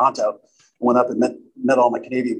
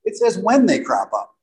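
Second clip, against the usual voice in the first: "when" punched higher to correct the wording; stress on WHEN; 4 semitones higher than usual. Shown as a demonstration. Speaker correcting a reading of the book, stress on "when". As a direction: lean hard on "when".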